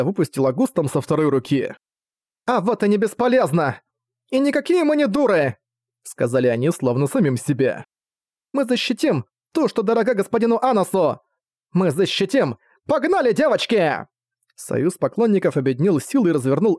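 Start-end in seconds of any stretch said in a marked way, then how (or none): 2.5-5.6 high, indignant comeback; stung but defiant; defiant retort. Acted. Girls defy the insult. 8.5-14.1 high-pitched, eager battle pledge; rally the girls to charge; spirited and resolute; rallying cry. Acted.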